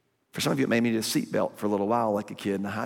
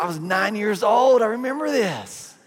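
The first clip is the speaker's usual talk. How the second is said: high, excited nostalgic gush; mock nostalgic gush; 10 semitones higher, 6 dB louder than usual. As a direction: squeal it like a fond childhood memory. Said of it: "you're like".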